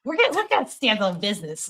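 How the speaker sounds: timid nerd saying it; nerdy voice. Shown as a demonstration. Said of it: "my nerdiest voice possible".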